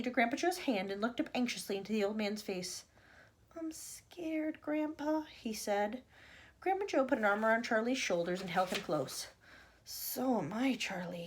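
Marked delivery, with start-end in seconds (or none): 3.6-5.2 small, high, soft voice; frightened boy. A narrator's reading. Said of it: "Charlie was beginning to feel frightened". 9.8-11.2 hushed confession. A narrator's reading. Grandpa comforts frightened boy, admitting fear.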